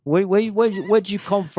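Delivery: hammed-up hotel heavy grilling the guest; Goomba security guy. Comic retelling the Vegas guard as a mock goon. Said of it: "Goomba guy from security calls".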